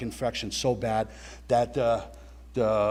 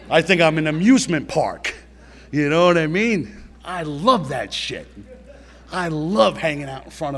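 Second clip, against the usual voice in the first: thrilled; 3 semitones higher, 7 dB louder than usual. Real, not acted. Relishing the drug thrill.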